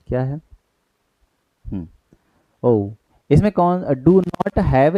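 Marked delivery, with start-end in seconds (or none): none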